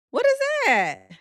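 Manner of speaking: high, loud disbelief; high, loud, baffled question; incredulous. Real, not acted. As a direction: shriek it in disbelief. Reacting to fake song title.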